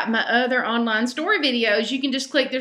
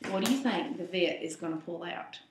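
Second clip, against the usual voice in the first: hushed question; 6 semitones lower, 11 dB quieter, faster than usual. A narrator's reading.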